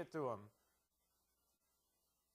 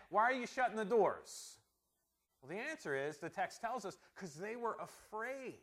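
normal talking voice

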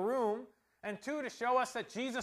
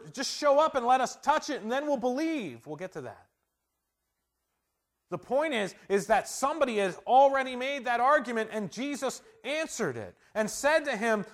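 loud, driving pulpit delivery; fervent preaching; 5 semitones higher, 6 dB louder than usual. Acted. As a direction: preach it with rising fire. Preaching at full force.

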